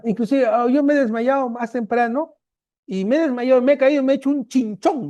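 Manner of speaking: a hint of a meek girl complaining; patient voice.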